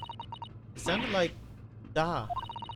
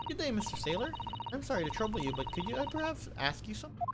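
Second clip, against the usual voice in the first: put-on polite young lady; 6 semitones higher than usual. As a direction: ask sweetly and timidly. Voices Susato asking politely.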